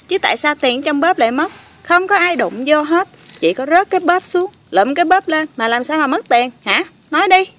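indignant accusation. Pretend. Girl accusing rescuers of emptying her purse, indignant. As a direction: argue hotly, peaking on the last demand. Acted quarrel over missing money.

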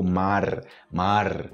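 "mar" with a strongly rolled final R; marked Spanish R. Shown as a demonstration. Teacher contrasts Spanish with Portuguese, marked rolled-R demonstration. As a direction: roll out the R ending "mar". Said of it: "o R no final é muito marcado".

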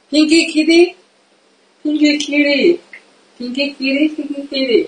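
sing-song coo at the kitty; cooing to a cat. Real, not acted. Streamer coos pinky kitty to the cat, high and playful.

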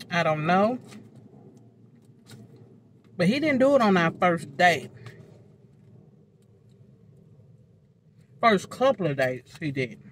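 talking while chewing; eating between words; mouth full of food. Real, not acted. Seen 5: fork brought to mouth repeatedly, chewing between phrases.

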